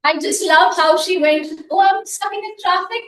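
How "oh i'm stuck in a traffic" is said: a higher mock-surprised quote; imitating surprise. Host mimicking a member's surprised tone. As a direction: replay her surprise lightly. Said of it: "in the surprising tone".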